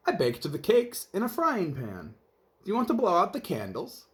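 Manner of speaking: sweetly literal housekeeper explaining; cheerful Amelia Bedelia. Acted. Narrator voices Amelia Bedelia.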